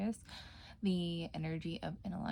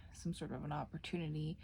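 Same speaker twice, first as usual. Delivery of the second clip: soft whispered aside to viewers; 2 semitones lower, 6 dB quieter, faster than usual. Acted. Tarot reader whispering her reading to viewers. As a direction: drop into a hushed ASMR whisper.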